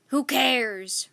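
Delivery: loud pirate roar; a pirate captain bellows. A narrator's reading. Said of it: "roared Captain Bones".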